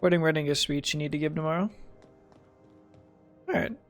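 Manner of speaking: ordinary talk, nothing marked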